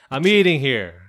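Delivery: loud New York guy, hammed up; New York accent. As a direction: bark it like a New York cabbie. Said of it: "I'm trying to do a New York voice".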